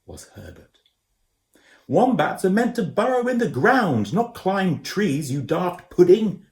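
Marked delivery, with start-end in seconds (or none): 1.9-6.4 high, loud scolding shout. A narrator's reading. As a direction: yell it up the tree at the daft wombat. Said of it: "shouted Koo".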